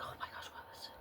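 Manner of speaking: soft breathy whisper, no voice at all. Retold or imitated. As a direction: whisper it like gossip in class. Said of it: "we're whispering back and forth".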